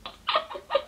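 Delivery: very high helpless laugh, close to sobbing; laughing instead of crying; real nervous giggle; tearful laughter. Real, not acted. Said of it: "I'm laughing instead of crying".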